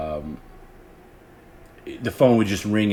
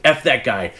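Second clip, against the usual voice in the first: louder, higher mock anger; infuriated Howard; 5 semitones higher, 5 dB louder than usual. Host who wouldn't say the swear word, infuriated. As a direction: imitate a host cursing in censored form. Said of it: "Howard would get infuriated by it".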